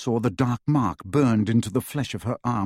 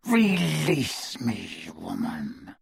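slow, low, menacing; growled warning; 4 semitones lower, 4 dB quieter, much slower than usual. A narrator's reading. Greyback threatening.

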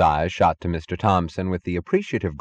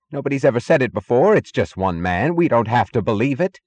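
raised, higher, urgent insistence; insistent; 5 semitones higher, 5 dB louder than usual. A narrator's reading. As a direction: keep insisting, clinging to denial. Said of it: "insistent sound of an effort to maintain a trance".

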